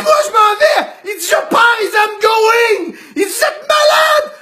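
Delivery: patient yelling that he is walking out; yelled exit. Retold or imitated. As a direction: shout that he is leaving.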